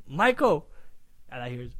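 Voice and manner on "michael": calling a name across the house; yelling out. Retold or imitated. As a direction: call him from the doorway. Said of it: "I yelled out".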